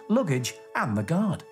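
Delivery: ordinary delivery, nothing marked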